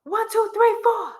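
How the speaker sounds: high sing-song count. Shown as a demonstration. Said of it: "This is what I heard".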